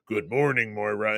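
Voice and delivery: low, distant hint of Charles; a calm voice from nowhere, lightly done; lightly imitated disembodied mentor greeting; Charles's voice. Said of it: "you hear Charles' voice go".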